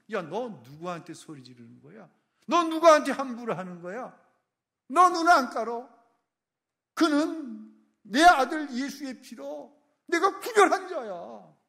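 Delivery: starts plain, then high, loud shouting; angry rebuke building. Acted. God rebuking those who oppress believers, rising to a shout. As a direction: build from a warning to a roar. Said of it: "그를 향해 하나님 말씀하시는 거".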